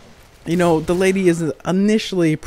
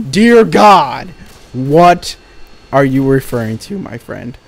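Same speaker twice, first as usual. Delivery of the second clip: exasperated outburst; 7 dB louder, slower than usual. Retold or imitated. Exasperated reaction to vague question.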